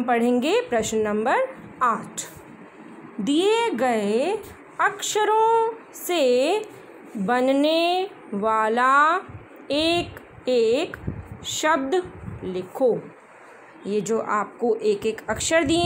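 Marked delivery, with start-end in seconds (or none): 3.2-13.0 slow reading aloud. Shown as a demonstration. Teacher reading a question aloud, slow and clear. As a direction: question read out slowly, word by word.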